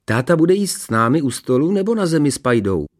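put-on high, innocent child's question; guileless little boy. A narrator's reading. Son asking his mother at breakfast, guilelessly. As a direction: ask it brightly as a guileless son. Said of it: "zeptal se bezelstně Honzík".

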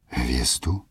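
breathless, whispered question; hushed disbelieving echo; 1 stunned whisper. Acted. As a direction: barely voice the word in disbelief. Princess's star stunned echoed.

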